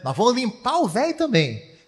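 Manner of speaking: high-pitched imitation of a bragging boy; cocky kid boast. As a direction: crow it like a cheeky little challenger. Little boy boasts about beating father.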